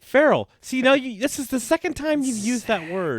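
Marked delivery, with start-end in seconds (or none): 0.0-0.5 1 incredulous echo. Real, not acted. Teasing repeat of her word.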